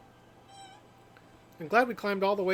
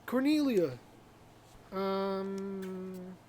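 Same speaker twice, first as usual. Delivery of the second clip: usual speaking voice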